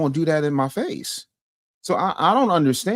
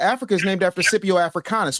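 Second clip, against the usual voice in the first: ordinary talk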